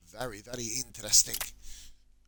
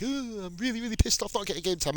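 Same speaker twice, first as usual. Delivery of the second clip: high, mock-whining footballer; mocking a whiny player; 9 semitones higher than usual. Mimicking his moaning player to viewers, a high mock whine. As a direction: take the mick out of a moaning player. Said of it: "He's the guy who's like".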